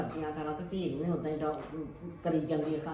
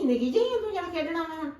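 higher, mocking jab; sarcastic grandma; 10 semitones higher than usual. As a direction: needle her for acting little. Grandma mocks grown girl.

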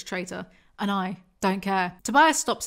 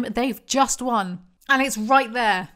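slow exasperated emphasis; 5 dB louder, slower than usual. Real, not acted. Exasperated rant.

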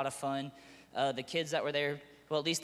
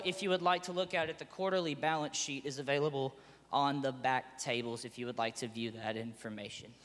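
normal talking voice

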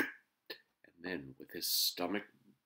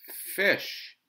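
FISH announced high and loud, falling; loud, boyish statement of "Fish"; called-out answer; 13 semitones higher, 13 dB louder than usual. A narrator's reading. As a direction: call out "Fish" as a sure answer. Boy calls answer to mother.

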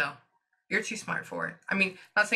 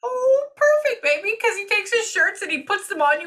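high, loud and delighted; excited; 13 semitones higher, 7 dB louder than usual. Real, not acted. Psychic thrilled that she sleeps in his shirts. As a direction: squeal with happy recognition.

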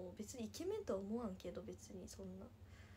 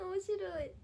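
laughing; 9 semitones higher, much slower than usual. Real, not acted. Laughing at her dad's coworker comparing him to Putin. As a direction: crack up as you say it.

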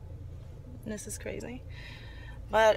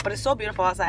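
normal talking voice

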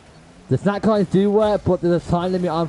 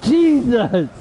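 real angry shout, high-pitched; annoyed outburst; 9 semitones higher, 4 dB louder, much slower than usual. Real, not acted. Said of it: "I'm getting annoyed".

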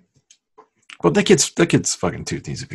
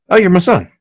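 a hammed-up, raised, louder "you're my son"; mock happy father; 8 semitones higher, 5 dB louder than usual. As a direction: act like the dad gladly accepting the kid.

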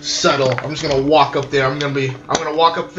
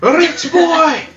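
screaming; 11 semitones higher, much slower than usual. Acted. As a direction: holler for the rich boy to come out. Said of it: "I'm gonna start screaming like".

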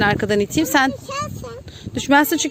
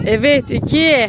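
normal talking voice

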